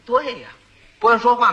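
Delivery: ordinary talk, nothing marked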